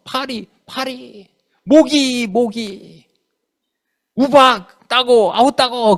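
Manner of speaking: imitating drawn-out pleas; pleading request. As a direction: act out fretful begging, stretching the words. Imitating Moses begging for plagues.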